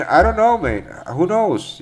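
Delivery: high, exasperated shrug of a voice; exasperated don't know. Real, not acted. Host admitting to chat he can't predict the pair, exasperated.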